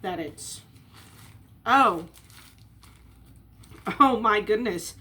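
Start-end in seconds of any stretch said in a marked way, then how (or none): 1.7-5.0 surprised. Real, not acted. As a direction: genuinely caught off guard. First look at messy burger.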